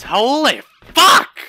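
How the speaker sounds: slow, loud startled scream. Real, not acted. Gamer screaming at a sudden shock. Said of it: "I'm screaming a lot".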